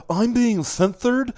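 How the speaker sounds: slow, high, whiny mock voice; mocking whiny complaint. Mocking writers who cry censorship, whiny high voice. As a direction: drag it out like a sulking complainer. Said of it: "Because it's like".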